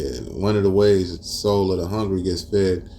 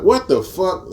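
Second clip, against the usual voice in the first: much higher, louder incredulous yell; outraged disbelief; 12 semitones higher, 4 dB louder, slower than usual. Retold or imitated. Reacting to the leaked video, a high, loud shocked yell. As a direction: blurt What the fuck in shock. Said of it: "I was just like".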